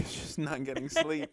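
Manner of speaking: slow, laughing tease. Real, not acted. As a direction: tease her through laughter. Teasing with laugh.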